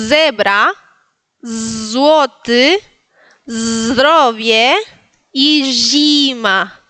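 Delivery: zebra, złoty, zdrowie, zima with long buzzed openings; drawn-out words, zima as ZHEE-ma; model Polish pronunciation. Shown as a demonstration. Teacher handing over the turn, slow spaced words. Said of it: "tu turno".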